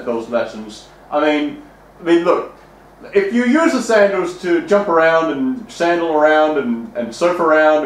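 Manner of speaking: deep, pompous voice lecturing on lessons; stuffy moralizing voice. Acted. Host questioning the guest, in a deep, pompous put-on voice. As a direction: talk down like a prim old schoolmaster.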